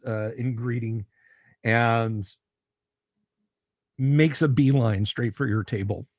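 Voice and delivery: normal talking voice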